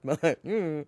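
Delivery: real giggle breaking out after holding it in; stifled "mm" spilling into laughter; guilty laughing. Real, not acted. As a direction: try not to laugh, then give in. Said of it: "I know I shouldn't laugh".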